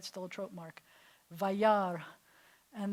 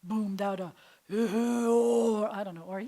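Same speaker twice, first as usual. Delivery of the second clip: louder, slowed mock boom; 6 dB louder, slower than usual. Shown as a demonstration. Teacher briefly hams up a booming God. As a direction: swell into a hammed-up boom. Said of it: "God couldn't have boomed out".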